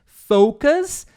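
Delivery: "focus" high and loud, first vowel a full OH; "focus" projected like a teacher's model, long O; proper pronunciation. Shown as a demonstration. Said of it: "that's the proper pronunciation".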